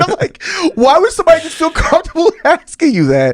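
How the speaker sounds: high, incredulous laughing talk. Real, not acted. Host baffled by random questions from strangers, laughing. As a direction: let laughter push the voice right up.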